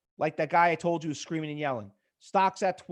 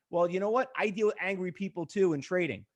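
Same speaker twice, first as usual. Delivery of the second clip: ordinary talk, nothing marked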